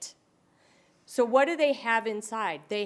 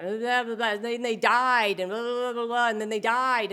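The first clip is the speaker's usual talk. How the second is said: imitating looping old man; 5 dB louder than usual. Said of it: "he was just looping".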